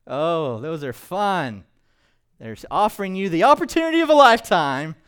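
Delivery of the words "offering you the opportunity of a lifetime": higher, louder and fast, a hard-selling pitch; pushy salesman. Retold or imitated. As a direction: rush it like a timeshare salesman closing a deal. Mimicking a pushy timeshare salesman.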